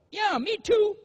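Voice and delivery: high, loud strained dodge; squeaky evasive husband. Retold or imitated. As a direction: squirm out of saying it. Husband squirms on phone with wife.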